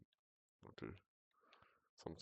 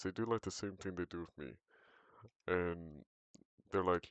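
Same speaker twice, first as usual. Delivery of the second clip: ordinary talk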